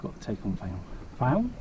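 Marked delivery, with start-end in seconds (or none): 1.2-1.5 questioning. Real, not acted. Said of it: "That's like the same as film".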